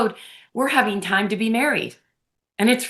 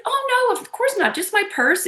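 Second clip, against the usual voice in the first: much higher, polite social voice; retold breezy reassurance; 11 semitones higher than usual. Freeing a bar seat for a stranger, high breezy reassurance. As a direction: imitate an airy, high-pitched "not at all".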